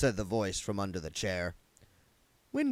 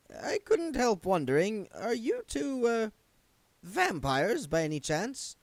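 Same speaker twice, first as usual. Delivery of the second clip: higher, timid zombie clerk; a nervous, polite little man, pitched up; Wendell's voice; 13 semitones higher than usual. A narrator's reading. Wendell's character voice.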